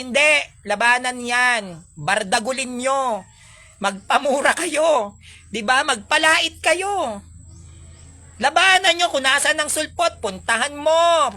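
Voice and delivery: loud, slow rallying call to fight back; fiery preaching. Acted. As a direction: rouse the crowd to take up the fight. Rallying preaching at full force. (maalab na pangangaral, malakas at mabagal)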